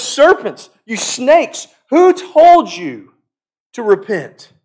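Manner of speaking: loud and high, slowing on who told you; angry rebuke. Retold or imitated. John the Baptist denouncing the Pharisees, angry rebuke. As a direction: thunder at the Pharisees. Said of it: "and he says".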